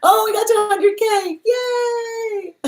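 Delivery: very high, squealing celebration ending in a shout; excited cheer. Real, not acted. Streamer celebrating a hundred thousand followers, excited squeal. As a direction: squeal with joy at the milestone.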